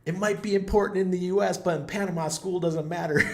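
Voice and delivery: high, loud lightly voiced guard; mock guard retort. Guard retorting about Panama school, high and loud. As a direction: imitate the rent-a-cop's smug comeback, up high. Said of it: "And he says".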